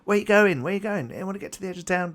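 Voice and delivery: high-pitched, overlapping taxi touts hustling for fares; shouting taxi touts. Acted. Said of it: "they're all kind of shouting at people".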